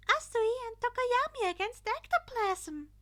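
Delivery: high, loud chirpy announcing voice; cheerful principal. A narrator's reading. Principal announcing the teams to the class, high cheerful call. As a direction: announce it like a bubbly host.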